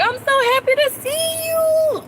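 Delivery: put-on very high, slow, sing-song baby talk; doting greeting. Mother greeting her daughter each morning, high cooing voice. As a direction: coo at a child you adore. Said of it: "I'm like".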